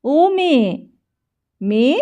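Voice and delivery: loud, high call from upstairs; an old man hollering for her; calling the daughter-in-law down loudly. A narrator's reading. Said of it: "বলে".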